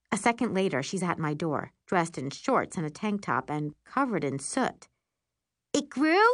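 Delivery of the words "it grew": surprised. A narrator's reading. Surprised sister at the TV.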